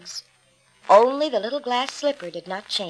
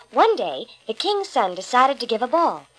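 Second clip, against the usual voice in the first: plain reading voice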